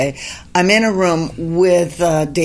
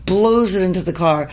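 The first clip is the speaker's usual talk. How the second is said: usual speaking voice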